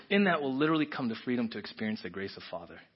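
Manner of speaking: ordinary talk, nothing marked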